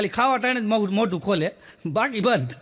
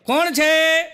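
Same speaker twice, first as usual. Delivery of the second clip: high, loud call at the door; wife calling who's there, raised; 6 semitones higher, 5 dB louder, much slower than usual. Retold or imitated. Wife calls at the doorbell.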